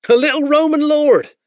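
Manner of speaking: high, loud mock-awed soldier; mocking spearman. Spearman shows off the boy, mocking wonder. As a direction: marvel at him mockingly.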